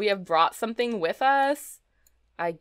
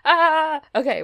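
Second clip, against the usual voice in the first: usual speaking voice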